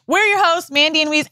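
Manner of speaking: high-pitched mock host intro; retold on-camera read, lifted high; reciting from memory. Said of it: "I'm trying to remember it".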